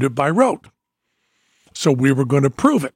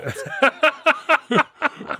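laugh; 13 semitones higher than usual. Real, not acted. Laughing at the audience reaction.

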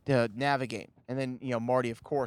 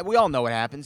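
usual speaking voice

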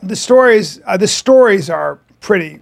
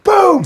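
a sudden high boom shout; mock impact shout; 12 semitones higher than usual. Acting out the martial arts hit, a high mock shout. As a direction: shout boom like a karate strike. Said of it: "He goes".

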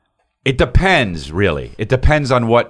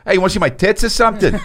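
put-on high, laughing brash New York accent; New York accent, laughing through it; mock Jersey girl; 9 semitones higher than usual. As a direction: crude Jersey chick, cracking up. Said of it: "You don't wanna go like".

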